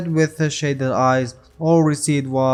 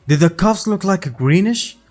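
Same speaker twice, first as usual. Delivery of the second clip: raised, slightly heated question; a little angry; 7 semitones higher, 4 dB louder than usual. A narrator's reading. Shanks asks Makino about the cuffs, a little angry. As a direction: question with a touch of anger. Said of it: "little angry now".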